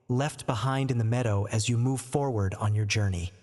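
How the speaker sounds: usual speaking voice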